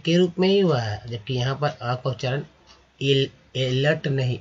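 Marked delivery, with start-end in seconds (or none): 3.6-4.1 एलर्ट opening on a long "ay"; mispronounced एलर्ट, starting like the letter A; wrong pronunciation. Shown as a demonstration. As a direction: say एलर्ट with an "ay" first vowel. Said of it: "एलर्ट नहीं बोलते हैं इसे".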